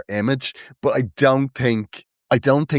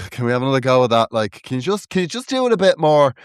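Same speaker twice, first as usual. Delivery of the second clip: fast, higher mock director; fussy director; 6 semitones higher than usual. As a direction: rattle off notes like a nitpicking director, a bit higher. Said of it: "those dickhead directors going".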